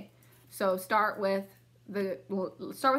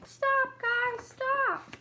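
mock yell; much slower than usual. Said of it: "he's probably going to yell".

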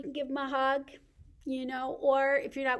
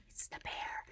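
gasped, hushed reveal; 11 dB quieter than usual. Pretend. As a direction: pretend gasp, then soft whisper. Reveals the next animal to toddlers.

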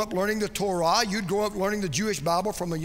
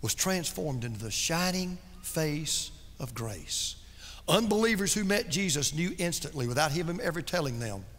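hushed preaching; 5 semitones lower, 6 dB quieter than usual. Acted. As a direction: draw the congregation in, much softer. Hushed intimate preaching passage.

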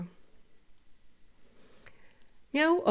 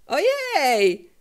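higher, louder strangled yelp; muffled yell; 7 semitones higher, 6 dB louder than usual. A narrator's reading. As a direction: yelp it while upside down. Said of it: "wrzasnął Felek przyduszonym głosem". (zduszony wrzask)

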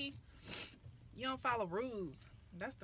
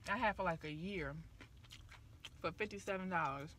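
talking while chewing; eating snack while speaking; mouth full; 3 semitones lower than usual. Real, not acted. Seen 0: Eats snack pieces, lips closed chewing between phrases, hand to mouth.

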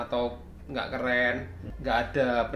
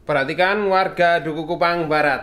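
loud, raised village announcer call; mock public announcement; 3 semitones higher, 6 dB louder than usual. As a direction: announce it to the whole neighbourhood. Mock public warning to neighbours.

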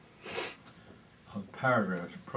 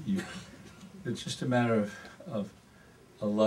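normal talking voice

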